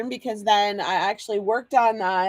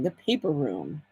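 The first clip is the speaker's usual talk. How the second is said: The paper room, said softly and lower to herself; quiet savoring; 6 semitones lower, 7 dB quieter than usual. Acted. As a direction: murmur the name as if tasting it. Savoring the store name.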